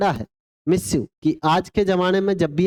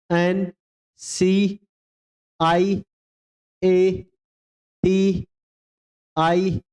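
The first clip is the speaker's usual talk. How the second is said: letter by letter spelling; much slower than usual. Shown as a demonstration. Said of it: "spelling बोलो".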